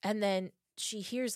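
ordinary delivery, nothing marked

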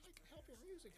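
high-pitched little troll voice. Acted. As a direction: squeak it like a tiny troll. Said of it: "you little fucking troll".

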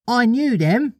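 put-on high little-boy brag; childish know-it-all voice; John boasting. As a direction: pitch it up as a boy showing off.

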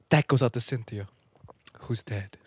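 quiet sign-off. Pretend. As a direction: drop to a hushed close. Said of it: "Like that".

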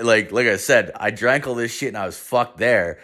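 plain reading voice

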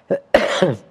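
a real chesty cough. Real, not acted. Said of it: "Pardon, sık sık öksüreceğim".

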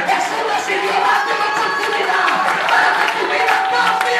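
loud, high shouted preaching climax; full-force preaching. Real, not acted. Preacher telling the prisoner his new chance, shouting in full force. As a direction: build to a shouted preaching peak.

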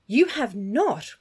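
slow, protesting outcry; cried out. A narrator's reading. Catherine protesting Thorpe's false message, a slow dismayed cry. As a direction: draw it out slowly in dismay. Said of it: "cried Catherine".

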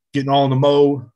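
ordinary talk, nothing marked

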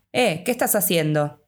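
usual speaking voice

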